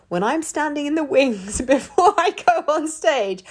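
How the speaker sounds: giggling as she tells it; nervous giggly telling; laughing. Real, not acted.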